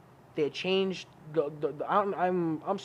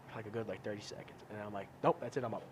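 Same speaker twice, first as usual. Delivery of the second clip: quiet drowsy; 6 semitones lower, 9 dB quieter than usual. Retold or imitated. Lying in bed quietly.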